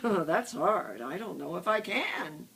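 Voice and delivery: plain reading voice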